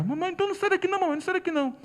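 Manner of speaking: put-on high, pleading child voice; cling to mom, afraid; boy begging his mother to stay, scared. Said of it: "Aí ele desceu com medo".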